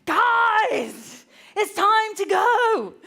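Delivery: high, loud, drawn-out yell; exasperated shout. Retold or imitated. Mum yelling at children who won't come, exasperated shout. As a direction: holler it, patience gone. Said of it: "there's a moment where I was like".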